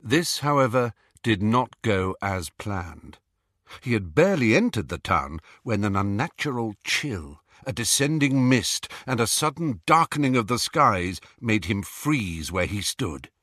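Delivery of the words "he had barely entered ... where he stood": ordinary delivery, nothing marked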